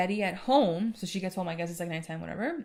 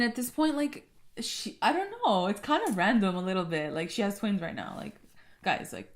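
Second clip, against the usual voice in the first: ordinary delivery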